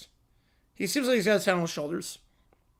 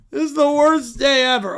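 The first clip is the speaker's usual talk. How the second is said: put-on loud childish wail; a sulking child's lament; whining kid; 7 semitones higher, 10 dB louder, slower than usual. Kid wails.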